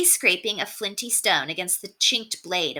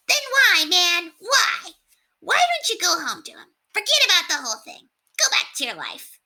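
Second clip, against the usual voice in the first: high rat voice, pleading; 13 semitones higher than usual. A narrator's reading. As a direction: squeak out the plea to go home. Rat character voice.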